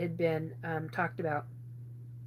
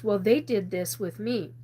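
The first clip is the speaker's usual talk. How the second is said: ordinary talk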